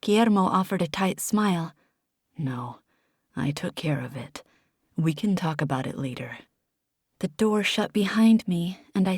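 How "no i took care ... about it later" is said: much lower and softer, calming; quiet reassurance. Acted. Guillermo calming a tearful Desi, low and soft. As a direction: settle her gently.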